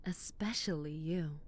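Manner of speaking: flirty whisper and giggle. Acted. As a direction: whisper it just for them, then giggle.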